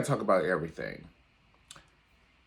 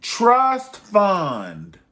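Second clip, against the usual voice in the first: louder, higher call of trust fund; 9 semitones higher, 8 dB louder than usual. Acted. Vlogger leading the class chant, loud call. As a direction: belt it out for the class. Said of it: "Say it with me".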